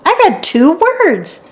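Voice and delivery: put-on girl proud of first reading; proud and delighted. A narrator's reading. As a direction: burst out with joy at reading. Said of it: "She laughed".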